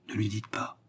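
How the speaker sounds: fearful murmur. A narrator's reading. Jailer secretly warns the clerk, hushed and fearful. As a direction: slip it quietly into an ear, afraid. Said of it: "glissa ces mots empreints d'une peur".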